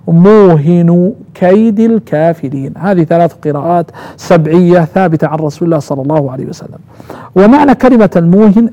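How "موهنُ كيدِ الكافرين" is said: موهن slowly as muuhinu, كيد as kaydi; third reading's vowels. Shown as a demonstration. Teacher closing the three readings, muuhinu kaydi for موهن كيد. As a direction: recite موهن without nunation, kasra on كيد. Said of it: "القراءة الثالثة".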